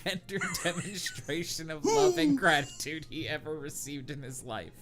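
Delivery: ordinary talk, nothing marked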